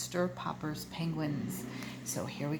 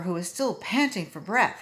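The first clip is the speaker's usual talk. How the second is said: ordinary delivery, nothing marked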